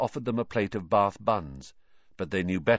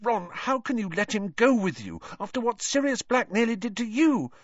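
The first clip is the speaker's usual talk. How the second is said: very high-pitched, urgent pleading; high, insistent girl's voice; Hermione, distressed; 13 semitones higher, 4 dB louder than usual. A narrator's reading. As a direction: protest in alarm, building to a threat.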